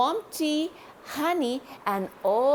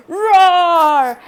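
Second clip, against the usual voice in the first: big loud dinosaur roar, higher; 5 semitones higher, 14 dB louder than usual. Acted. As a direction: roar out like a dinosaur scaring a friend. Dinosaur roars to scare friend.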